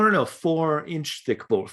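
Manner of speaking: usual speaking voice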